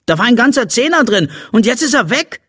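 put-on high, rushed boyish outrage; outraged complaint. A narrator's reading. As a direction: blurt out the loss in high-pitched indignation. Boy outraged at lost money. (empört, hoch und hastig)

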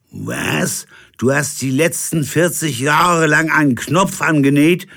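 high, loud disbelief; can't believe it; incredulous captain. Acted. Captain questions forty years of sewing, high.

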